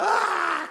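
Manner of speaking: grito de alegría. Pretend. Niño grita de alegría al quedar solo.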